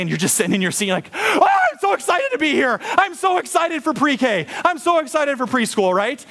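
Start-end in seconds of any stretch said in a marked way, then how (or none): 1.1-5.9 put-on very high squealing little-kid voice; hammed-up childlike thrill; excited kindergartner. Acting eager kindergartner answering attendance.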